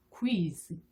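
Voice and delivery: "quiz" as k-w gliding into ee, ending in z; drawn-out English "quiz" with a voiced z. Shown as a demonstration. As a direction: link ku, i, z slowly into quiz. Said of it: "훨씬 더 영어스럽습니다".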